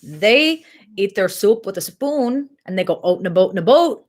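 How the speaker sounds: imitated Minnesotan with long round O's; mock Minnesotan, rounded vowels; Minnesotan accent.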